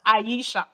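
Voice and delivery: AISHA said as "ah-ee-sha"; Nigerian-style AISHA with an open "eye" start; Nigerian pronunciation. Shown as a demonstration. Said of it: "I'm using my Nigerian accent".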